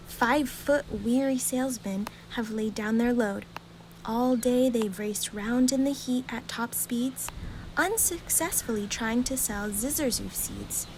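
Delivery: ordinary delivery, nothing marked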